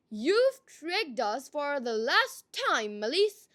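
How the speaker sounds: loud, slow angry outcry; crying out. A narrator's reading. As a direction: call it out in fury, slowly. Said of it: "cried the villagers".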